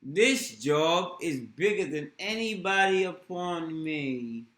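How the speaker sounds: put-on hamming up the London accent; imitating Cockney.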